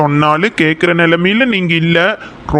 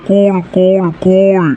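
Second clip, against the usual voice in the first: calming; slower than usual. Acted. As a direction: settle her down, half teasing. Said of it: "கொஞ்சம் கூலா பேசுங்க".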